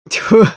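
loud spitting "Tfu" of relief; relieved spit. Acted. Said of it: "с облегчением сплюнул Антип".

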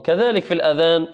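normal talking voice

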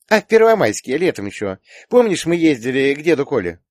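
put-on high, loud girlish reminder; chatty daughter. A narrator's reading. Daughter reminds father of trip. (высокий, громкий голос дочки)

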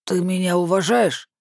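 pushy tipsy woman; lightly voiced drunk demand. A narrator's reading. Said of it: "требовательно мотнув головой".

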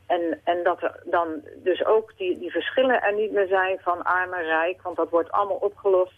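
usual speaking voice

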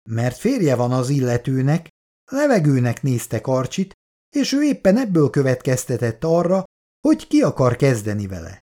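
ordinary delivery, nothing marked

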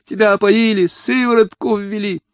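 high, frantic screaming protest; wailing. A narrator's reading. Mother claiming her son was drugged, wailing. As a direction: wail it at a very high pitch. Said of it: "завопила она".

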